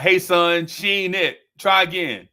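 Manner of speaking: loud, blunt mock-dad verdict; dismissive father. Father judges son's girl in front of her, loud mock dismissal. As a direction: call it out as a dad imitation, loud. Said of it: "He like".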